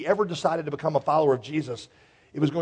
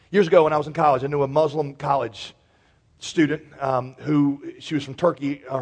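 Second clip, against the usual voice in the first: usual speaking voice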